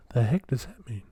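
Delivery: baffled whisper to himself; hushed, confused aside. Real, not acted. Puzzling over the label, in a whisper.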